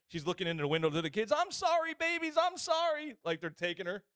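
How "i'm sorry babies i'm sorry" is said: very high, louder wail; hammed-up tearful begging; mock crying plea. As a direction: imitate a mother wailing apologies to her kids. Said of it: "she starts crying, pleading".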